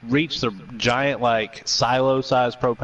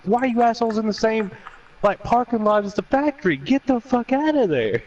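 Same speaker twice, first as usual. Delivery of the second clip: raised, pitched-up shout across the lot; high mock yell; 11 semitones higher than usual. Brother shouting at people across the street to get away, high yell. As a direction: call it out high and urgent.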